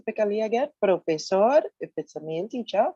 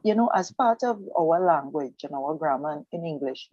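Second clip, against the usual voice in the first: normal talking voice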